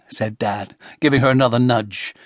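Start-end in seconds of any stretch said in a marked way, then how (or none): none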